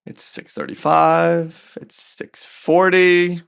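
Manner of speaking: ordinary talk, nothing marked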